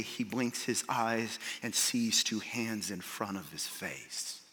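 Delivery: low, soft, intimate storytelling; hushed wonder. Real, not acted. Preacher imagines watching the blind man healed, hushed. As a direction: paint the moment quietly, letting it fade away.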